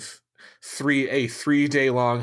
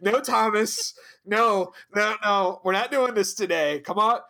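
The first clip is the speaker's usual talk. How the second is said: higher-pitched retold scolding; acted firm telling-off, pitched up; hammed-up reining in of a troublemaker; mock scolding; 8 semitones higher than usual. Acts out captain scolding Tom.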